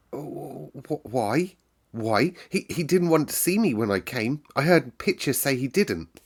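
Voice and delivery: put-on a frightened girl stammering. A narrator's reading. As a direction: stumble over the words in fear. Mary frightened at summons.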